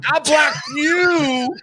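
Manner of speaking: high, slow, loud outburst of apology; excited shout. Real, not acted. Host shouting his apology to a fan he blocked, loud and drawn out. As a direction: burst out loudly, stretching the words.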